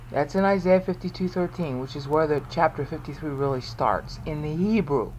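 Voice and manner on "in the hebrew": normal talking voice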